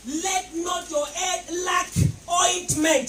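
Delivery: shouted scripture. Real, not acted. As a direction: shout the verse, very slow and drawn out. Preacher shouts the scripture verse.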